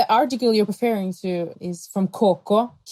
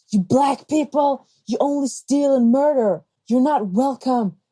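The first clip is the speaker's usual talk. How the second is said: imitated hostile yell; 6 semitones higher than usual. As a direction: mimic a stranger's hateful outburst on the subway.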